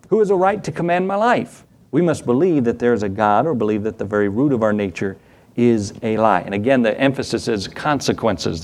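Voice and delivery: ordinary delivery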